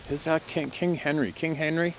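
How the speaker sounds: lifted, teasing question about the name; teasing the audience about the client's name; playful teasing. Real, not acted. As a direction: ham up the royal title, asking the audience.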